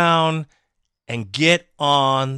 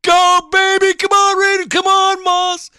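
high, loud yell at the TV; screaming fan; 9 dB louder than usual. Retold or imitated.

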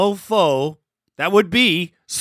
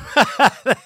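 host laughs; 6 semitones higher than usual. Real, not acted. Host reacts to caller's rant.

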